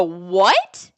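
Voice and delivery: loud, stunned rising "What?"; shocked. Retold or imitated. Hearing the attacker press charges, she gasps in shock. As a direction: disbelief bursting out. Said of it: "I'm literally in shock".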